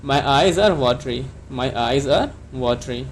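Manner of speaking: slow, clear model sentence for learners; careful classroom dictation pace; slow teaching model. Shown as a demonstration. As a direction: say it slowly so students can repeat. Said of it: "तो आप इसको कैसे कहेंगे?".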